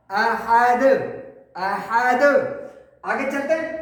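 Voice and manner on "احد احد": احد with a bouncing, echoed final د; qalqalah. Shown as a demonstration. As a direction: let the closing د spring back. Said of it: "قلقلہ،".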